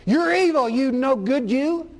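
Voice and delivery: loud, high harsh scolding; harsh, judgmental. Acted. Preacher acting out how God doesn't talk, harsh and judgmental. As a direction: condemn them loudly in a mock judgmental voice. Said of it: "in a harsh, judgmental way like".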